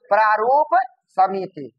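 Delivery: slow, two separate words; dictating. Shown as a demonstration. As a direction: hold प्रारूप, pause, then समिति. Teacher repeats name for class.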